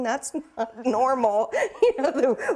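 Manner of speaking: laughing through the words; amused disbelief, laughing it out. Real, not acted. Patient laughing about chatting on the way to surgery.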